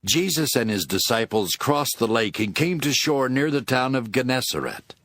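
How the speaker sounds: ordinary delivery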